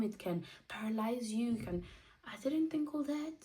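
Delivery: ordinary talk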